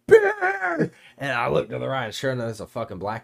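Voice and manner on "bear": very high, loud shriek of Bear!; mom's shrieked warning; retold scream. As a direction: scream Bear! like a startled mom. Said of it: "my mom scream".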